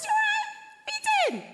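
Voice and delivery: squeaky high, fake friendly check-in. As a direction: go way up high, hiding the annoyance. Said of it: "when our voices go high like that".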